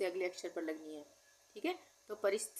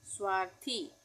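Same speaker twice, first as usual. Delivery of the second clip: ordinary talk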